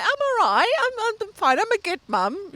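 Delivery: fake-bright self-reassurance; insisting I'm fine. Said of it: "And every time I'm like".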